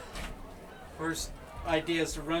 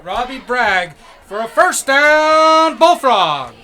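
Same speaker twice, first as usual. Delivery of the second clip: slow, booming stadium announcer call; PA announcer impression; 7 semitones higher, 17 dB louder, much slower than usual. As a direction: stretch it out like a stadium PA. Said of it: "[name] doing his best Argonaut P A announcer impression".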